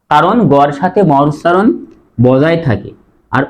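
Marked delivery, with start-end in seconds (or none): none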